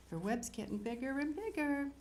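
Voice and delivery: plain reading voice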